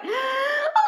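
high crying gasp, rising; a sharp wailing gasp; sobbing gasp. Acted. Gasp cry after rambling.

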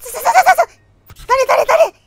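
high, loud stuttering panic; panicked stammer. Real, not acted. Streamer spotting something in the dark, panicked stammer. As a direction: stumble over the words in fright.

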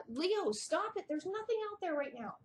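high-pitched telling-off to a pet; high, exasperated scolding; scolding the dog. Real, not acted. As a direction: stop the dog barking. Scolding her dog mid-video.

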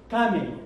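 "Kame" stressed on KA, falling after; first-syllable stress. Shown as a demonstration.

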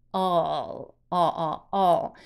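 final L as a long, low "哦"; drawn-out "哦" for an L at the end of a word; dark L sound. Shown as a demonstration. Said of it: "它就不发了音了". (词尾L拖长发成“哦”)

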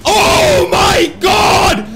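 exasperated wailing shout; frustrated shouting. Real, not acted. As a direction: scream at the broken car. Car refuses to accelerate.

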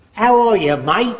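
cheery imitation of an Australian; a hint of Australian twang; Australian accent. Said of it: "that guy comes from Australia".

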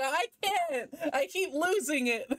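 high-pitched, laughing protest; exasperated, laughing squeal; laughing exasperation. Real, not acted. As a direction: give up helplessly, breaking into laughter. Exasperated laughing at lost accent.

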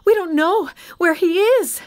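high, loud, panicked report; gasping alarm. A narrator's reading. Mary to Peter and John after running, gasped. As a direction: blurt it out in breathless distress. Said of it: "she gasped".

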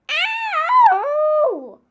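loud high yelp of pain; high squealing yelp. Acted. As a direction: yelp as if pricked. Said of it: "he squealed".

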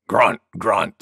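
low grunting boar voice; low-pitched pig character; wild boar. A narrator's reading. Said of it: "said Wild Boar".

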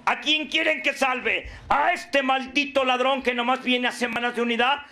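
lightly acted Pilate, pitched a little up; Pilate addressing the crowd. Pilate asks the group whom to save, lightly acted mock orator. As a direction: put each candidate to the crowd as mocking questions. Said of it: "Cuando el Pilatos dijo".

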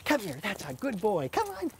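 bright, high-pitched praise for the dog; high-pitched coaxing to a dog; high happy dog call. Real, not acted. Trainer calling dog in high voice.

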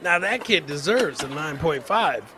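usual speaking voice

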